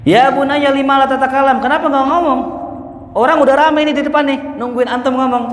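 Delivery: high, loud urging call to a son. Acted. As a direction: rouse him to speak up, loud and high. Ali urges the silent shaykh.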